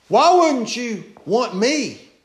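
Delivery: slow, high, loud appeal; pleading call. Acted. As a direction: plead like a spurned friend. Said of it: "crying out".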